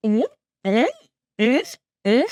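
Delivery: high squeaky little creature; tiny high-pitched creature chirps; Koromon voice. Shown as a demonstration. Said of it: "Koromon talks like this".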